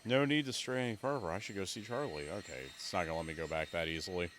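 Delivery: usual speaking voice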